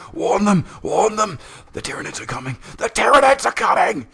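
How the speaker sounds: screamed warning, very high and loud; terrified shrieking warning, high and loud; hoarse scream. Acted. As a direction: scream it out in terror, cracking high. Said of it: "With a hoarse scream".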